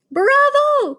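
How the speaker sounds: very high, loud cheer; cried. A narrator's reading. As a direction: cheer with delight. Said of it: "cried the witches".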